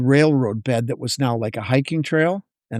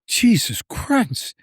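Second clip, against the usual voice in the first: exasperated; 8 semitones higher, much slower than usual. Retold or imitated. Reacting to the story.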